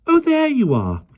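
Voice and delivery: put-on high, bright child's call; high voiced girl, gaily. A narrator's reading. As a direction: Jane calling out happily. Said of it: "cried Jane gaily".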